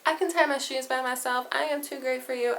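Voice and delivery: put-on high, proud little-kid voice; bratty toddler. Imitating a two-year-old bragging, high and proud. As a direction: brag like a stubborn toddler. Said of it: "how two-year-olds are like".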